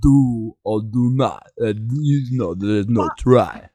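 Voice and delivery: Rocky impression. Host quoting Yoda as Rocky, lightly voiced low mumble. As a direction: mumble it like a punch-drunk boxer. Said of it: "Hugh Jackman's character in Rocky".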